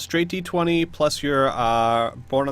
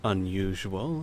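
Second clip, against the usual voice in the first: soft, low, slow noblewoman turning serious; hushed and deliberate, taking the threat in; Lady Trask; 4 semitones lower, 6 dB quieter, slower than usual. Acted. Baroness learns of a plot to rob her, quiet seriousness. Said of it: "look a lot more serious, and she says".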